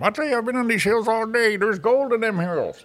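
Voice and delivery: put-on old gold miner voice; grizzled prospector. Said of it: "you gotta do it with a limp too".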